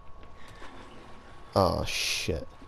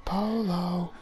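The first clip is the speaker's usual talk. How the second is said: loud, high playful call; 9 semitones higher, 4 dB louder, slower than usual. Real, not acted. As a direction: call into the dark like a game. Calling out to the stalker.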